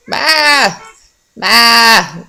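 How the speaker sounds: high mock call of Ma; imitating baby yelling. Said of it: "just yelling like that".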